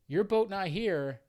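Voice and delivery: put-on high, loud broken-English Vietnamese accent; imitated Vietnamese accent.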